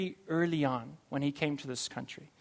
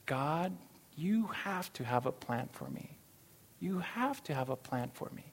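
quiet prayer; 2 semitones lower, 9 dB quieter than usual. Retold or imitated. Retold prayer beside his car.

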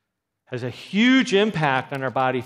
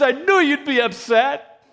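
daughter's whine; 14 semitones higher, 10 dB louder than usual. Retold or imitated. Daughter quoted, mother upset.

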